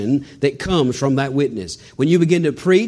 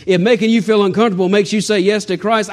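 ordinary talk

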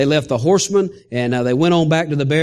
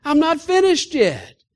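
high, impassioned promise that slows at the end; voicing God with fervent, stretched-out reassurance; God's answer; 11 semitones higher, slower than usual. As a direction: answer the complainer as God, insisting. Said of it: "God said".

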